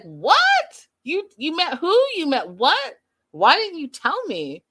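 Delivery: high shocked outburst. Retold or imitated. Mother shocked at her son's news, loud surprise. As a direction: gasp out the question in disbelief. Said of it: "Chantel's like".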